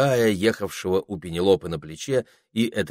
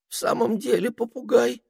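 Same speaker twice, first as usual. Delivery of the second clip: high relieved squeak; ermine voice. A narrator's reading. Ermine recognising the parrot, high.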